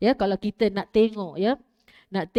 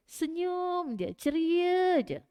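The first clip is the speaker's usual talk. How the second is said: higher, very slow and gentle, with a smile; smiling demo; 8 semitones higher, much slower than usual. Shown as a demonstration. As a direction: show a sweet, unbothered smile in the voice. (senyum manis, tinggi dan perlahan)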